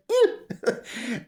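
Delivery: imitated high little eek; cartoonish squeal; Misty. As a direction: squeak it like a small creature. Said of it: "Like, Misty does".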